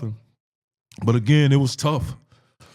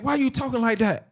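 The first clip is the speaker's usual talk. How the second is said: raised-pitch mock scolding; retold maternal scolding; 10 semitones higher than usual. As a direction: imitate mom telling you off in a higher voice. Retold mother scolding after slap.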